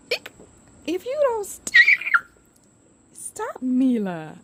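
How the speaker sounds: high squealing, slow plea; being tickled. Real, not acted. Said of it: "you were tickling the stuffing out of me".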